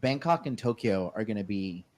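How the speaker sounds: normal talking voice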